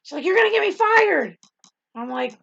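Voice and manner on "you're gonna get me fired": loud, high imitation of alarmed boss; mock exasperated protest. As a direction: protest loudly in hammed-up dismay. Administrator protests, acted out.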